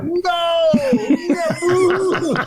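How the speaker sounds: high, laughing crowd "no, no"; crowd protest. Retold or imitated. Audience booing the comic, high mock protest. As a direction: mimic the crowd objecting. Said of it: "people were like".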